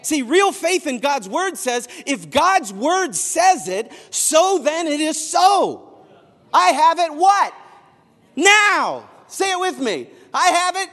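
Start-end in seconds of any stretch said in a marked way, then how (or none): 2.1-10.9 loud, high and slow, calling the crowd; fervent call and response; preaching call. Real, not acted. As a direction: drive it home to the congregation.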